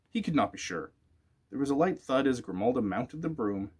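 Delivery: ordinary delivery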